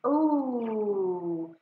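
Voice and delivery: long gliding ooh of wonder; surprised ooh. A narrator's reading. Luna marvels at the bubbles, a drawn-out ooh. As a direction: let the ooh float up in awe. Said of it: "her surprised".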